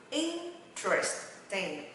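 "Interesting" broken slowly into "in - tres - ting"; slow syllable demo. Shown as a demonstration. Teacher drilling learners, very slow "Interesting". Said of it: "Đọc nhanh ha".

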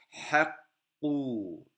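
hakku said slowly, the doubled k held before the u; slow model reading. Shown as a demonstration. Teacher slowly models hakku for the class, doubled k held. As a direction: stretch hakku out, the kk held long. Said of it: "şedde ve damme var".

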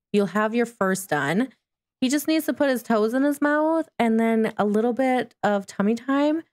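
ordinary talk, nothing marked

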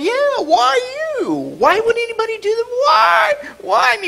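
mock wail. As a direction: whine along like the hurt skater. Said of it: "you're going".

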